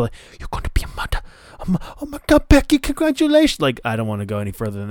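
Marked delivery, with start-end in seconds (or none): 0.4-1.2 hushed, voiceless stage whisper. Retold or imitated. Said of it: "and the whisper". 1.6-3.6 very high, gushing congratulations; excited squeal. Retold or imitated. Asuka reacting to the news.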